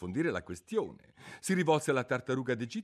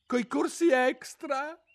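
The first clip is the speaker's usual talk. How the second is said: worried; 7 dB louder, slower than usual. A narrator's reading. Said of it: "con voce preoccupata".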